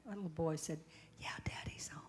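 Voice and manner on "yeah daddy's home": whisper. Retold or imitated. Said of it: "he whispered when he answered".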